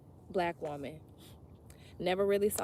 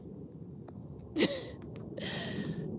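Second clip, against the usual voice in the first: a high airy laugh at her own joke; high breathy laugh; 8 dB quieter than usual. Real, not acted. Reader laughing at her crybaby quote, amused laugh.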